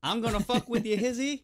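high-pitched playful rapper impression; comic impression of a teasing rapper. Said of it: "fucking [name]'s around".

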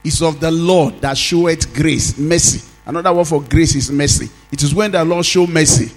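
emphatic preacher, stretching key words; forceful preaching. Real, not acted.

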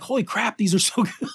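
high, amazed, laughing through it; a giddy burst of surprised delight; excited disbelief. Retold or imitated. Reacting to the Bad Batch show, amazed and laughing. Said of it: "I'm like".

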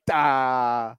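flat dumb "Da"; empty-headed grunt; blank brain voice. Retold or imitated. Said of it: "My brain in that moment just was like".

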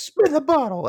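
high-pitched imitation of a yell; mock yell. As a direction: call it out high, no real volume. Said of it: "And she, like, yells".

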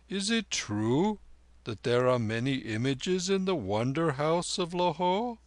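deliberate, wondering elder; old lama inquiring. Acted. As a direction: ask earnestly as an old holy man. Lama asks, making sure.

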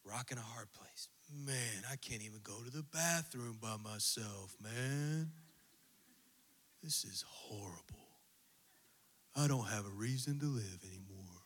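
low, gloomy whispered mope; Eeyore. Pretend. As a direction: sigh it out like a hopeless donkey. Eeyore voice for Winnie the Pooh.

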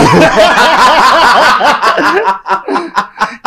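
high burst of laughter; loud laugh. Real, not acted. Reacts to a cheeky joke.

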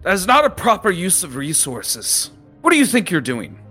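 high-pitched lecturing captain voice; berating captain. Acted. Captain lecturing the students on wasted privilege, high-pitched and stern. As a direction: scold them like a disappointed old captain.

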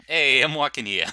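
loud New York tough guy; brash Brooklyn street voice; New York accent. Pretend. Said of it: "give the character some life".

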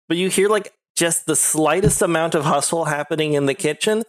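ordinary delivery

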